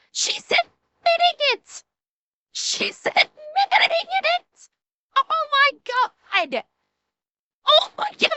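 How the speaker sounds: high, fast, laughing disbelief; shrieking disbelief. Real, not acted. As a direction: squeal in disbelief at the clip. Reacting with disbelief to the clip.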